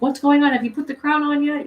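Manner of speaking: high, loud, urgent call. Retold or imitated. Fiona and Cake calling Simon, loud and urgent. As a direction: shout to him from far away. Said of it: "calling Simon".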